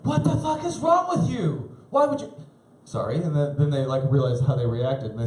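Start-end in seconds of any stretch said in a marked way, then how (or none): 0.0-2.3 mock-outraged customer voice; shocked customer. Customer at the door shrieking at the pizza guy, high and appalled.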